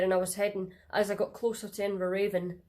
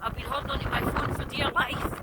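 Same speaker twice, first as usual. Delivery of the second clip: shaky from the wind; 3 semitones higher than usual. Real, not acted. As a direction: voice shaking, buffeted by a gale. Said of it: "sorry about the shaking about".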